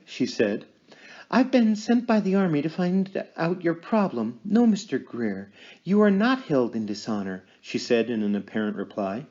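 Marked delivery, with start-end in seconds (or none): 1.3-7.4 raised, intent medium in a trance. Acted. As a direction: speak as if channeling a spirit. Medium addressing the spirit.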